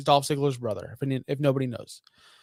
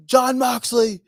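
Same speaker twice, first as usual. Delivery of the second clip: John Moxley called out high and loud, mocking the boss; mock callout; 8 semitones higher, 6 dB louder, slower than usual. Podcaster mocking Tony Khan calling Moxley out, loud. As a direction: call the name out like a fired-up boss.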